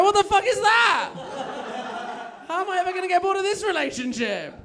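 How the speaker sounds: very high-pitched yell of delighted disbelief; amazed outburst. Retold or imitated. Boyfriend reacts to her strange jinx, high amazed outburst. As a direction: burst out, pitch soaring in mock amazement.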